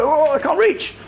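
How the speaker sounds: high-pitched comic wail; mock helpless complaint. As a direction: play up being too short and stuck. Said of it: "I was always like".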